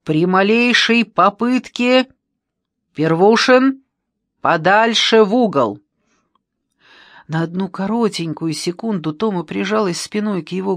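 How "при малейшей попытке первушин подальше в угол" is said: slow, loud military-style orders; raised, deliberate command. A narrator's reading. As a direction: give firm orders with drawn-out words. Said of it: "скомандовал".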